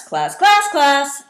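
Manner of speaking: "class, class, class" loud, leaping high then stepping down; three calls of "class" on different pitches, middle one highest; changing intonation. Shown as a demonstration. Teacher calling "class, class, class", loud with changing pitch. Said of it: "change the intonation of your voice".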